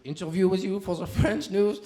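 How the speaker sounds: light put-on French accent asking politely; playing a French reporter, French accent; mock French reporter in a French accent. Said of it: "I'd walk up to".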